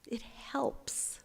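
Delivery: softly whispered it helps, rising; whispered afterthought it helps. Shown as a demonstration. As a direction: let it go in a hush. Whispered echo of same words.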